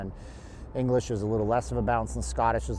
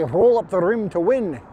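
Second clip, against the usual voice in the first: louder, rolled-r Scottish burr; rolling Scottish burr; 6 semitones higher, 7 dB louder than usual. Retold or imitated. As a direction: roll every r like a Scot. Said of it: "they say".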